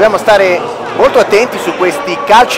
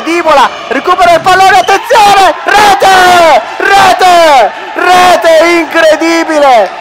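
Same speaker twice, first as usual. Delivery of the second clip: shouted goal call, higher and louder; breathless italian goal commentary; 9 semitones higher, 3 dB louder, slower than usual. Real, not acted. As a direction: erupt as the goal goes in. Goal scored, commentator erupts.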